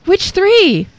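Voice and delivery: high, loud question; Lucifer. Acted. Demon demanding to know the keys, high-pitched. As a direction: shoot it back high and urgent. Lucifer asks Satan, punchline setup.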